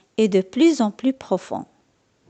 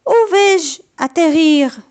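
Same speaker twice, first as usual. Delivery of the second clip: crying out; 6 semitones higher, 6 dB louder than usual. A narrator's reading. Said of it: "crie Patapon".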